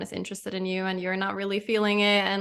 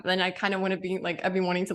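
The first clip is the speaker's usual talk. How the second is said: usual speaking voice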